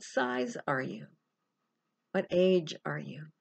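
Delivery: unhurried meditation guide, long pauses between phrases; guided meditation. Real, not acted. Coach leading listeners through a best-self visualization, calm guided meditation.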